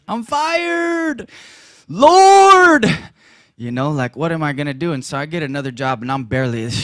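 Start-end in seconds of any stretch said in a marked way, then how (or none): 0.1-2.9 high hammed-up wail to the Lord; mock wail. Preacher telling wife of firing, hammed-up high wail. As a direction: cry out in comic despair, high.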